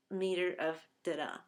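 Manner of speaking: ordinary talk, nothing marked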